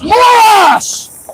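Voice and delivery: high, full-throated shout; top of lungs. Real, not acted. Rallying viewers, a high full-throated shout. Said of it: "At the top of my lungs".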